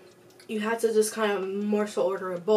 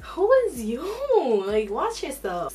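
incredulous; 4 semitones higher, much slower than usual. Retold or imitated. Indignant retort.

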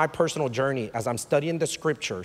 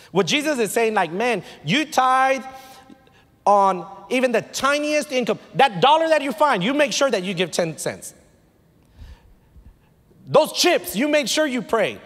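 emphatic preaching; 9 semitones higher, 4 dB louder than usual. Acted. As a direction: preach it higher, leaning hard on key words.

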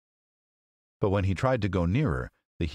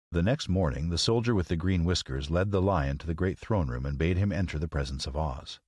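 plain reading voice

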